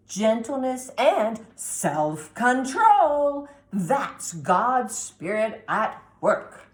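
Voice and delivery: loud, slow, sweeping grandma; slow, loud, preachy grandma. A narrator's reading. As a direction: boom it out like a grand old grandmother. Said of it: "she says".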